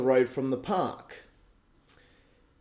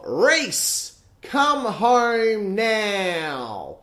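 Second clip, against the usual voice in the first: high, slow mock call of the mum; quoting mum's call; 5 semitones higher, much slower than usual. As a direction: drag out the call like she did. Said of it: "take that quote of".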